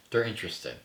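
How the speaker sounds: usual speaking voice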